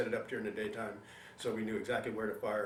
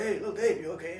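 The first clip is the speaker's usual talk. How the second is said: called out higher and louder; loud concerned call; 7 semitones higher, 7 dB louder than usual. Retold or imitated. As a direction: call to a buddy down on the ground. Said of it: "Big Dave comes up and says".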